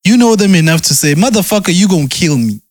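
normal talking voice

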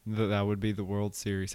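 normal talking voice

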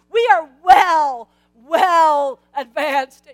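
loud, slow, stretched-out well advanced; mock-serious slow insistence; drawn-out emphasis. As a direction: lean hard on every word of well advanced. Stretched emphasis on old age.

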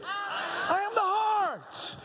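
high, slow, gritty shout of "I am the heart". Shown as a demonstration. Leading the congregation in a gritty shouted chant. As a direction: growl it out with grit. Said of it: "Now say it gritty".

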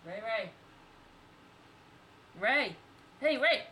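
hey Ray, calling the cat. Real, not acted. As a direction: call the cat across the room.

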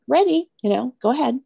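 lightly mock an eager little scribe; goofy, perky go-ahead; playfully goofy. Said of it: "I was being super goofy".

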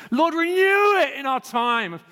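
high, drawn-out plea to God; impassioned prayer. Real, not acted. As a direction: cry out to the Lord, slowly. Preacher cries out in prayer.

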